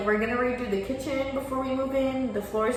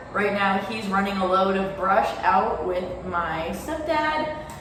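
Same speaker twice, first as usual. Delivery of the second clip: ordinary delivery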